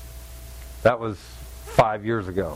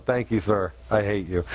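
fake polite; 10 semitones lower than usual. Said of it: "On the outside, I'm going".

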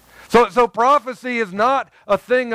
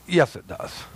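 low, emphatic contradiction; 4 semitones lower, 4 dB quieter than usual. Real, not acted. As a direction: state it firmly in a dropped pitch. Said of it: "how I said that so emphatically".

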